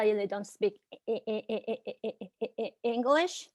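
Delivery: blocks on E- over and over; stuttering. Real, not acted. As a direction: repeats the first sound, then pushes through. Speaker who stutters stuck on word.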